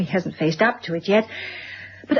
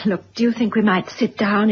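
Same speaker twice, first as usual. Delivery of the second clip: normal talking voice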